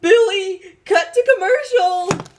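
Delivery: high, loud panicked wail; alarmed cry. Acted. Host begs Billy to cut to commercial, panicked cry.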